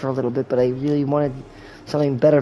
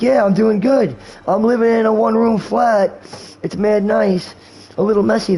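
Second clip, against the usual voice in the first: higher-pitched imitation of a pleased friend; mock upbeat bragging; 6 semitones higher than usual. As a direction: imitate someone insisting life is great. Acts out friend saying he's fine.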